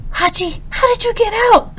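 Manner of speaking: high startled call; surprised cry. A narrator's reading. Professor finding his puppy outside the gate, high surprised cry. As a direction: cry out in alarm at the escaped dog. Dog escaped through the gate.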